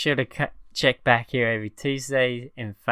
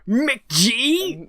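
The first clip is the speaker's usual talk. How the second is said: high, loud shouted call of a name; mock shout; 12 semitones higher, 4 dB louder than usual. Cohost yelling the director's name as a joke, mock shout. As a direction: bellow it out like a running gag.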